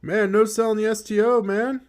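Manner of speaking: high, loud amazed protest; incredulous outburst. Real, not acted. Gamer yelling at the opponent who shrugs off his move, in high disbelief.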